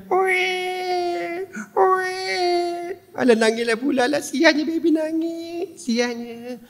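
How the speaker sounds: high, whimpering weepy voice; mock crying. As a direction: sob it out pityingly. Imitating crying baby scene.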